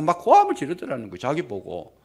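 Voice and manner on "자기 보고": usual speaking voice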